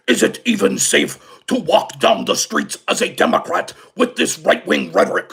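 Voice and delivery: lightly put-on senator voice; Bernie Sanders. Host quotes the senator asking about safety, lightly mimicked.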